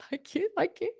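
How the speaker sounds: high, soft, laughing thank-you; bow out of the applause with a giggle; husband's mock thanks. Husband thanking his wife for the applause, high and giggly. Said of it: "He's like".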